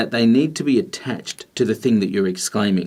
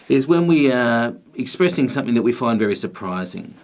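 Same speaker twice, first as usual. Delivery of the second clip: usual speaking voice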